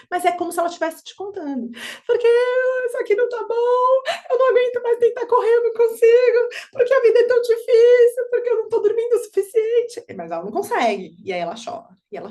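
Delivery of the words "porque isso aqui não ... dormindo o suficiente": put-on high, loud tearful child voice; crying child. Mother voices what the crying baby would say, high tearful wail. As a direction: wail it like an exhausted little kid. Voicing crying toddler's meaning.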